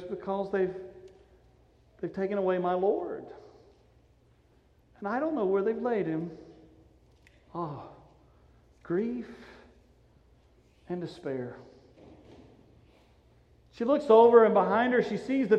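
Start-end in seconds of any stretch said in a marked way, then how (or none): 0.0-6.3 soft, halting sorrow; grieving answer. Acted. Mary answering the angels about her missing Lord, grieving answer. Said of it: "And she goes". 7.5-11.6 soft, halting sigh of sorrow; hushed despair. Acted. Preacher dwelling on Mary's loss at the tomb, hushed despair.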